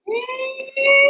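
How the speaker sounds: high whooping yee-haw, way up; cowboy call. Shown as a demonstration. Teacher shows the class the cowboy call, whooping high. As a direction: let out a big ranch yell. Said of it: "cowboy call".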